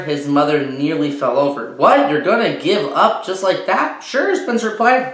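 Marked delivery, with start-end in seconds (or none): 1.8-4.0 louder, higher disbelief; astonished mother. Acted. Mother reacts to Spencer giving up a toy, loud and startled. 4.0-4.3 put-on breezy boy. Boy's breezy reply.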